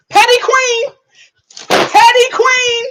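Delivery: high, loud cheering call. Real, not acted. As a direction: hype her up like a fan in the crowd.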